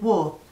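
war as "waw"; war, British way, long open ending. Shown as a demonstration. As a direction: repeat war firmly with a silent r. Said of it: "You don't say the R in war".

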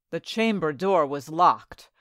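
usual speaking voice

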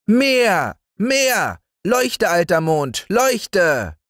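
put-on high, bossy child yelling; demanding little boy. Häwelmann ordering the moon to shine, high bossy yell. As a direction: order the moon about like a spoiled small boy. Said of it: "schrie Häwelmann".

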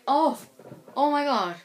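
excited re-enacted yelp; retold fan scream. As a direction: act out a starstruck outburst, held short of screaming. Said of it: "I was screaming my head off".

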